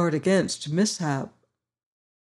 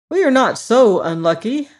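higher, louder cheerful straw man; Scarecrow voice; 5 semitones higher, 6 dB louder than usual. Acted. Scarecrow boasting to his friends, high and loud. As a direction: play the proud new ruler, brighter and up. Scarecrow speaks in throne room.

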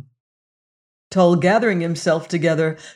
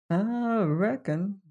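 slow, softer drawn-out reckon; slow reply; 3 semitones higher, 6 dB quieter, slower than usual. Acted. The rider answering Venters, slow and quiet. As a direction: let it come out slow and soft. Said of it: "replied the rider slowly".

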